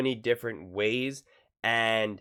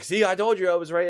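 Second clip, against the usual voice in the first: high, loud crowing; hammed-up I-told-you-so; mock gloating; 7 semitones higher, 5 dB louder than usual. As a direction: imitate a boastful know-it-all, voice pitched up. Acts out gloating once proven right.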